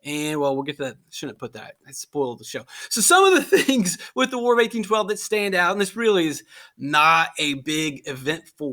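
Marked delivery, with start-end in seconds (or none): 0.0-2.6 muttered aside. Real, not acted. Catches himself spoiling the story.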